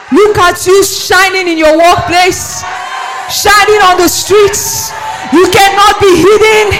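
loud, climbing proclamation; fervent preaching. Real, not acted. Pastor declaring blessing over the congregation, loud and fervent.

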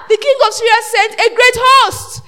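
normal talking voice

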